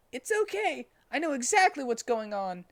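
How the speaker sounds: put-on squeaky young sidekick; Tails voice. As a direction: kid character piping up high.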